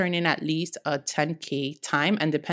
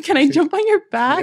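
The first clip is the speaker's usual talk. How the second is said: snotty crying; 11 semitones higher, 9 dB louder than usual. Retold or imitated. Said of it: "ugly crying".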